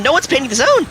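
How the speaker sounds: high, urgent caster yell; exasperated shouting. Real, not acted. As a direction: yell at players ignoring the objective. Exasperated live call, zone ignored.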